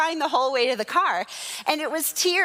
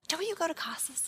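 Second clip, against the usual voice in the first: very soft imitation of a shopper; tentative question; 3 semitones higher, 11 dB quieter than usual. Shopper recognising a churchgoer at Goodwill, hushed imitation. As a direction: drop right down for a hushed, cautious question. Shopper cautiously approaches pastor, retold.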